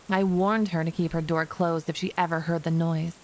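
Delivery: plain reading voice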